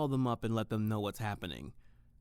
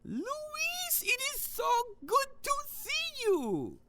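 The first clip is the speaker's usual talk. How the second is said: very high, slow, gushing greeting; delighted Hans; much slower than usual. Acted. Hans greeting his ex Luis on the fridge screen, gushing high. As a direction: stretch it out, thrilled to see him.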